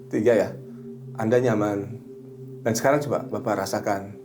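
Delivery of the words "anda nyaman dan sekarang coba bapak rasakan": lower, measured trance-guiding voice; hypnotherapy suggestion. Shown as a demonstration. Hypnotherapist tells client they will wake refreshed, low measured suggestion. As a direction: guide them into relaxation, pausing between phrases. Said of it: "saya bisa menuntun".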